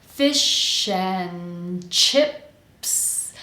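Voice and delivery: "fish and chips" very slowly as "fish-an-chips"; connected, dropped D. Shown as a demonstration. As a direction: stretch and link FISH AND CHIPS without the D. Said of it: "connect everything when we drop the D".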